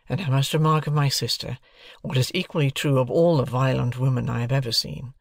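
plain reading voice

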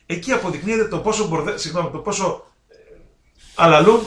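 ordinary delivery

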